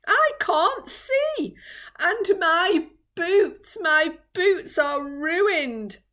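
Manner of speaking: high, loud, slow lament; distraught whining. Acted. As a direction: wail it in despair, dragging the words. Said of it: "he cried".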